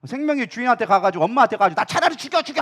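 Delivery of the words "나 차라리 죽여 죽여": high-pitched petulant demand; child's tantrum. Acted. Child going to mother to demand death, petulant. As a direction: throw a mock fit, voice pitched up.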